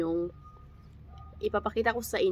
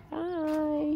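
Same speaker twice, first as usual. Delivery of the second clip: cutesy hi; 8 semitones higher, 3 dB quieter than usual. Real, not acted. Greeting the angry dog.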